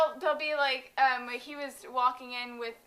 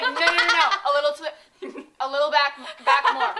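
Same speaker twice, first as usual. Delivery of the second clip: loud, laughing bossy directions; laughing claw-game coaching; 3 semitones higher, 10 dB louder than usual. Retold or imitated. Kid coaching a sibling at the claw game, laughing. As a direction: call out directions through laughter. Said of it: "me and Trey doing the claw game".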